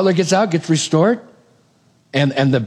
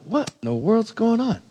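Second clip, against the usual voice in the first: bewildered; 5 semitones higher, 6 dB quieter than usual. Retold or imitated. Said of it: "Wouldn't you be going like".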